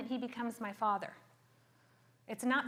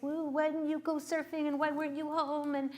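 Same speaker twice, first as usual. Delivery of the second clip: raised pitch, confronting the husband; mock badass confrontation, pitched up; in his face; 4 semitones higher than usual. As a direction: get up in his face and scold. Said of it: "I'm in his face".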